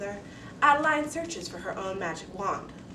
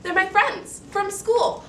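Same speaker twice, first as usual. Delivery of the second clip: put-on high, loud, slow girl's voice; a nervous child answering up; anxious girl; 11 semitones higher, 10 dB louder, slower than usual.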